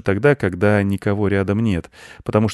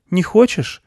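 put-on high boy's question; high-pitched young boy asking gently; little boy; 12 semitones higher, 5 dB louder than usual. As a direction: ask in a high child voice.

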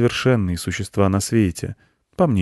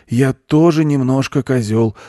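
higher, louder confident model phrase; goat teacher; 6 semitones higher, 6 dB louder than usual. A narrator's reading. Goat shows children what to say, confidently. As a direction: state it firmly for others to repeat.

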